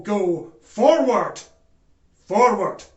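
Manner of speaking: loud, ringing call; rallying cry. Acted. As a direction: punch FORWARD like a marching order. Echoing the rallying password.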